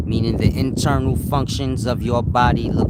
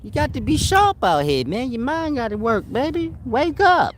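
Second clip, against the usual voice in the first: higher-pitched put-on old-timer voice, teasing the listeners; mock old man hustler, much higher voice; 13 semitones higher than usual. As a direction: play an old street-wise elder, pitched up and playful. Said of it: "That's my old man voice".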